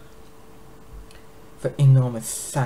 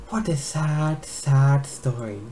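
slow, drawn-out fake sympathy; mock pity; 3 semitones higher, slower than usual. Dragon queen mocks the prophecy with fake pity. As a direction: pretend to grieve, savouring it.

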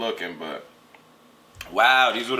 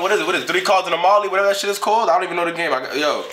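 ordinary talk

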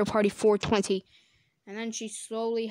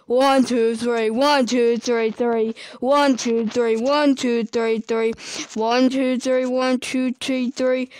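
put-on loud childlike counting chant; loud counting kid; 2 semitones higher, 11 dB louder, slower than usual. Baby Bubbles counting to three, loud and kiddish. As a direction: bellow the numbers like a toddler.

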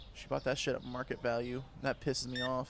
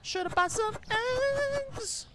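much higher sing-song taunt; mocking taunt. Shopkeeper taunting a customer who forgot eggs, high sing-song mockery. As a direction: tease the shopper in a high mocking lilt.

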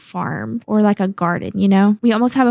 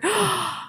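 sharp gasp; 9 semitones higher, 6 dB quieter than usual. Real, not acted. Spots baby creeper.

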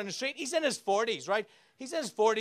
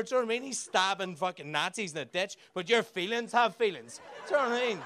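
usual speaking voice

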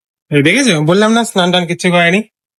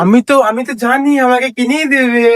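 imitating daughter; 6 semitones higher than usual. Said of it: "এরকম করে শোন".

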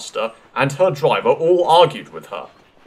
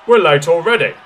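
loud, higher-pitched complaint; grumbling; 5 semitones higher, 9 dB louder than usual. A narrator's reading. Passengers are held up by the argument, grumbling. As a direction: grumble loudly with impatience. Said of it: "grumbled the passengers".

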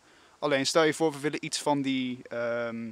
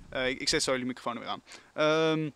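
ordinary talk, nothing marked

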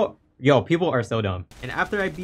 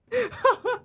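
real laugh, much higher; a sudden giddy high laugh; squeaky high giddy laugh. Real, not acted. Laugh after excitement.

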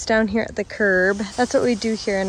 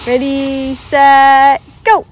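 playful race countdown; 5 semitones higher, 10 dB louder, much slower than usual. Real, not acted. Starting a race for son.

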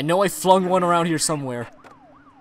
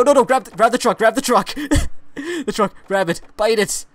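ordinary delivery

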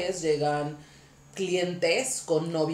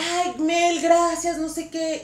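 high, sweet mock voice; sweet thankful client; 10 semitones higher, 5 dB louder, slower than usual. Client thanking the stylist in a high sweet voice. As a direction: imitate a gushing client.

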